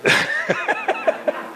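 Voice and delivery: sudden high squeal of a laugh; laughing at his own drill joke; high burst of laughter. Real, not acted. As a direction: break into a high laugh mid-sentence.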